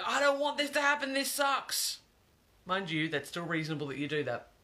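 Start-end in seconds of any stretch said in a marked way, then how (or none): 0.1-2.0 high, loud mock whine; a hammed-up imitation of someone resisting change; whiny complainer. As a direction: whinge it like a sulky kid. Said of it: "rather than going".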